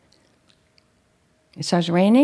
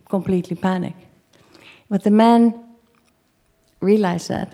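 normal talking voice

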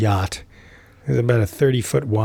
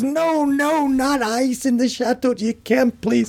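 much higher, pleading in mock horror; horrified plea; slower than usual. Father watches the host put ice in fine wine, horrified high plea. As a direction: beg him to stop as if it physically hurts. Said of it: "to my father's horror".

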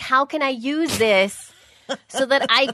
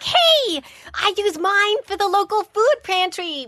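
much higher, chirpy over-cheerful voice; bubbly commenter; 10 semitones higher than usual. Pretend. Cohost imagines the commenter sounding cheerful with exclamation points. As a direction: sell it with exaggerated cheer. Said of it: "would have been like".

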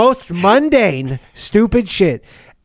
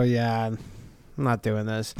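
quiet refusal; 8 semitones lower, 12 dB quieter than usual. Acted. Acts church quietly refusing.

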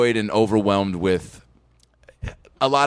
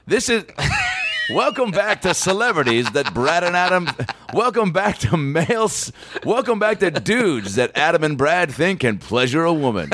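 show host intro; 7 semitones higher than usual. Pretend. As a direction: hype it like a cheesy show opener.

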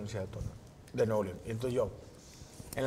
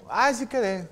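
high, loud, prissy "ay, sí quedé"; lightly mocking singsong; mock smug classmate; 8 semitones higher, 5 dB louder, slower than usual. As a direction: imitate a smug kid who passed.

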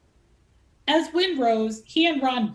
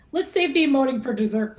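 1 teasing; 5 semitones higher, 5 dB louder, faster than usual. A narrator's reading. Wynn teases Ron.

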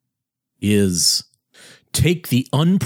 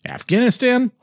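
mock incredulous; 13 semitones higher than usual. Real, not acted. Mocking dismissal of the war.

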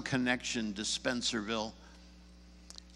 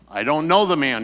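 much louder, emphatic denial; insistent denial; 3 semitones higher, 12 dB louder than usual. Retold or imitated. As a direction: push back hard against the accuser. Peter's second denial, pressed harder.

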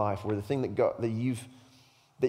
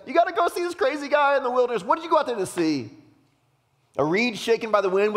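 very high, loud, fast outburst; frustrated challenge; 12 semitones higher, 6 dB louder, faster than usual. Acted. Jesus challenging crowds who flocked to see John, frustrated. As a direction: throw it at the crowd, worked up. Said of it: "He's a little angry".

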